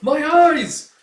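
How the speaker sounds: very high, loud cry; pained scream. Acted. Blinded crossbowman, high pained scream. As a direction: shriek as the light blinds you.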